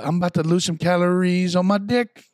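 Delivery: quoting a comedy song. Retold or imitated. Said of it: "He's also that one that".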